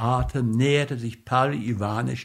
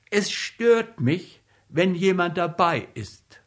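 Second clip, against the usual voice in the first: higher, polite but firm student; young student voice; 5 semitones higher than usual. Acted. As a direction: ask him to leave, politely. Mitja voiced in the story. (höfliche junge Studentenstimme)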